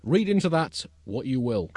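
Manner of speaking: high, loud, emphatic roll call; announcer. Acted. Host reading out famous birthdays, mock-grand announcer delivery. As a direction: proclaim each name to the crowd, slowing at the end.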